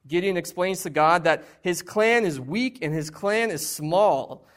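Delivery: ordinary talk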